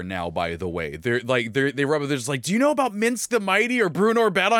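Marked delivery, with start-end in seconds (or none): 2.4-4.6 high, fast, gushing kid impression; imitating excited nephews. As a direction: blurt it like an eager child imitated by an uncle. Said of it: "they're running up to me and talking".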